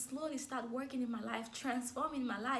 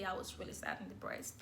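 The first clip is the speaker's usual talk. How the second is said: hushed; 5 semitones lower, 6 dB quieter than usual. Real, not acted. Repeats in hushed voice.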